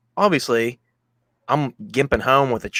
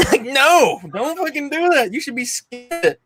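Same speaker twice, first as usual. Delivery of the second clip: mock warning; slower than usual. Mocking own past self, warning.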